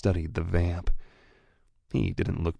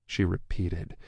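plain reading voice

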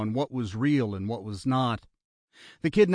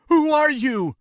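high, loud rattled yell; panicked shout; 7 dB louder than usual. A narrator's reading. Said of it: "Barry shouted".

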